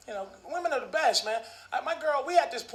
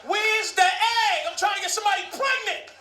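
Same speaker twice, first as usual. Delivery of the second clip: angry sperm shout; 8 semitones higher than usual. Pretend. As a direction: storm in hunting for the egg. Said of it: "my fucking sperm was like".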